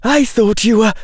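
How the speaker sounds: high-pitched disbelief; astonished. A narrator's reading. Girl to the visitor she just recognised, astonished. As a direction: say it high, as if stunned.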